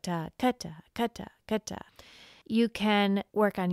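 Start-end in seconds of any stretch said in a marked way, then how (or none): 0.0-1.8 "ga" punched as the short note, fading softer; accent the short note. Shown as a demonstration. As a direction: hit the short "ga" in "Da, ga". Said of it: "accenting the shorter note".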